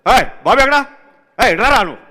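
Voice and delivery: high, loud call for the assistant; imitating the director calling out loudly; director shouting. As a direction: yell across the set, high and loud. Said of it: "టక్ టక్ వచ్చేస్తారు".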